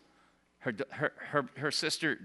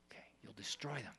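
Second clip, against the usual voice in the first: whisper; 5 semitones lower, 16 dB quieter than usual. Real, not acted. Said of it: "Never touch your children".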